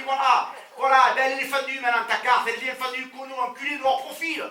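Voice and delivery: loud, high, contemptuous banker yelling; angry banker. Retold or imitated. Banker insults the client while cutting his card, yelling. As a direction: slash the card and curse him out loudly.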